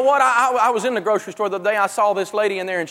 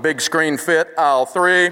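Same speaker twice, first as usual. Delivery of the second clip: intercom announcement; 4 semitones lower than usual. Retold or imitated. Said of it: "they came over the intercom".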